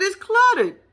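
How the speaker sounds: high, loud scornful put-down; mock disgusted retort. Host mocking the venue's old furniture, loud scornful retort. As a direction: sneer at the room. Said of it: "I said".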